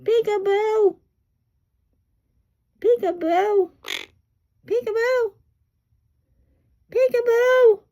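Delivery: very high, loud parrot-style calls; imitating parrot talk. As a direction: squeal it like a talking bird. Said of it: "He says".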